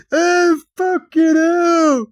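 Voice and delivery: high, loud, stretched-out lament; mock anguished wail. Human who just lost a mirror, mock anguished wail. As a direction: howl the curse in exaggerated despair. Acts out wailing over broken thing.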